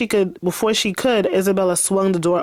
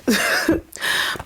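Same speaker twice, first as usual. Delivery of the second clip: breathy giggle; 6 semitones higher than usual. Real, not acted. Laughs at her character.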